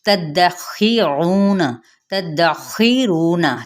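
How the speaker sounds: तद्दखीरून said slowly, the द doubled and the vowel drawn out; slow recited demo. Shown as a demonstration. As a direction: recite तद्दखीरून syllable by syllable like a tajweed teacher. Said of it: "आपने इस तरीके से पढ़ना है". (धीमी तिलावत वाला उच्चारण)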